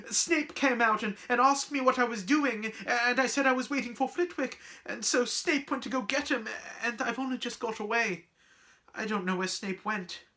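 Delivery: high-pitched, flustered lament; wailing. Acted. Girl explaining to friend she lost track of teacher, wailing. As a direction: pour out the excuse in distress. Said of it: "she wailed".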